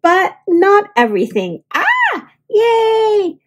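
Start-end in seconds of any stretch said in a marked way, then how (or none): 1.7-3.4 high happy squeal; excited cheer. Acted. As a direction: cheer with delight. Kids cheer.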